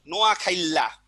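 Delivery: নোয়াখাইল্লা with "khail-la", i before a doubled l; colloquial pronunciation. Shown as a demonstration. Teacher gives an everyday example, colloquial epenthetic i. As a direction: say নোয়াখাইল্লা firmly, ai glide into long l. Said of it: "আমরা অনেক সময় একটা কথা বলি না".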